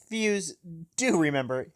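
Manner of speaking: ordinary talk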